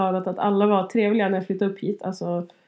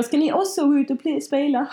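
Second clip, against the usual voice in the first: high, lightly put-on Scanian twang; mock Scanian accent; 7 semitones higher than usual. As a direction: tease in a southern Swedish accent. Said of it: "dina dialekter".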